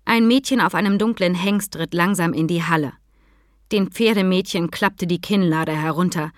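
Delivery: ordinary delivery, nothing marked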